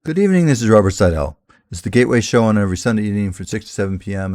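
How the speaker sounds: booming welcome back to the listeners; radio host opening. Acted. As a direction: open the show like a radio host.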